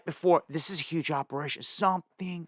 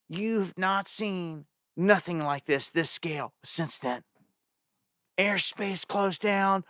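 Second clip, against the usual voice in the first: usual speaking voice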